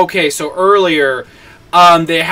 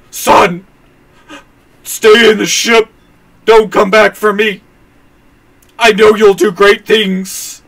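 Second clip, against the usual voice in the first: higher, hammed-up heroic farewell; dramatic dying father; 4 semitones higher, 4 dB louder than usual. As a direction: overact a father's last words to his son. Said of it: "I'm making it sound more dramatic than he was".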